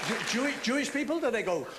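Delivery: ordinary talk, nothing marked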